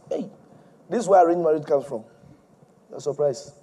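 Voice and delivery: ordinary delivery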